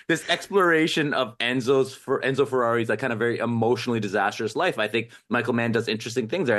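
ordinary talk, nothing marked

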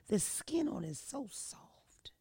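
breathy hushed whisper. Acted. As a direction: lean in and whisper it like a secret. Describing the soft skin.

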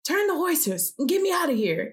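high, strained urgent cry; groaned plea. A narrator's reading. Wounded king to his chariot driver, groaning. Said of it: "Ahab groaned to the driver".

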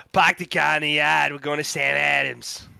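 put-on a jokey Boston accent in the Sam Adams line; mock Boston accent. Mocking friends' Boston accent with a jokey Boston accent. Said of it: "does a Minnesota accent ... New York accent".

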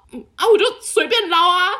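can barely get it out for laughing; giggling mock-innocent shrug of a reply; laughing through a quote. Voicing friend's reply, laughing.

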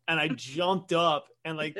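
ordinary delivery, nothing marked

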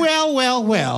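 very high opening, sliding down to normal; high weaselly voice. Retold or imitated. Small funny friend repeating his catchphrase, high and weaselly. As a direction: imitate a squeaky little sitcom sidekick. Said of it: "still had that voice, it would be like".

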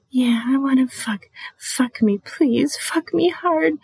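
high, rising begging voice that climbs to the end; playful high moaning plea; breathy pleading. Shown as a demonstration. Demo of pleading dirty talk.

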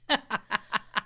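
brief disbelieving chuckle; a quick laugh at the absurdity; short incredulous laugh. Real, not acted. Laugh after the punchline.

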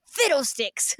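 put-on a prim old gent muttering his mildest swear; lightly voiced old man going fiddlesticks; quaint old man's curse. Old man reacting to penalty loss.

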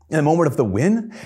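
usual speaking voice

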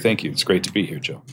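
normal talking voice

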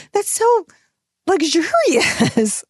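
high, slow, stretched-out luxurious; savouring luxury. Real, not acted. Mom imagining free time, dreamily drawn out. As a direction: roll it out slowly like silk.

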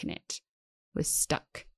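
whispered, almost breathless. Real, not acted. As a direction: let it drop to a whisper. Trailing emphatic whisper.

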